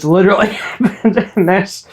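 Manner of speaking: breaking into a laugh mid-phrase; amused, laughter bubbling into the words. Real, not acted. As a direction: say it through a laugh, pitch lifting. Laughs while describing the scene.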